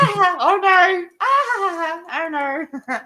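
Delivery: high, slow, hammed-up yelps of alarm; mock panic. Retelling rolling the car downhill, high hammed-up alarm. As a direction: wail it high as the car rolls.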